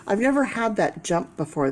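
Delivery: ordinary talk, nothing marked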